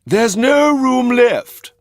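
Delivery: very high, loud and slow; drawn-out sly refusal; smooth coachman. A narrator's reading. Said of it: "said the coachman".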